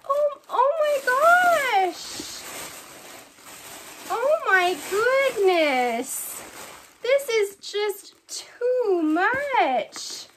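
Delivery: very high, slow, tearful cries of delight; overwhelmed squeal. Real, not acted. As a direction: squeal it out slowly, on the edge of tears. Overwhelmed opening big gift.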